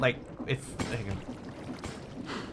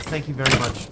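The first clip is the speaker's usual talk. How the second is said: normal talking voice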